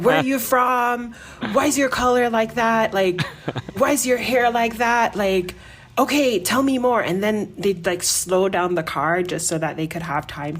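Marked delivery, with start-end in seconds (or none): 0.0-7.0 eager, higher, louder questions; curious drivers. Retold or imitated. Taxi driver quizzing a foreign passenger, eager and loud. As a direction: pepper her with questions like a nosy taxi driver. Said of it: "You know, like".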